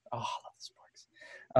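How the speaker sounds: slow, breathy whispered confession. Pretend. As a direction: whisper it like a secret love. Comic aside about sporks.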